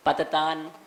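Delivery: पततान ending on n for m; wrong ending. Retold or imitated. Teacher quoting a pronunciation students may have heard, wrong ending. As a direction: finish पततान with an open-lipped n. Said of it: "कहीं कहीं उच्चारण सुना होगा".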